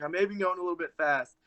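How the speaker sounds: quick, rushed admission; sheepish dad. Retold or imitated. Dad quickly admitting to his son he was speeding. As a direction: hurry through it like a guilty dad. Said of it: "And he was like".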